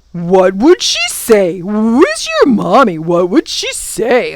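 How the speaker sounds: loud, mocking creature questions; taunting gaboon. A narrator's reading. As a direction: needle her in a loud creature voice.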